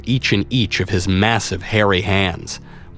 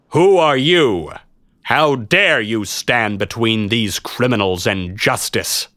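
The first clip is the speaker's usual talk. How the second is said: stern knight; 2 semitones higher, slower than usual. Acted. Knight challenges the intruder.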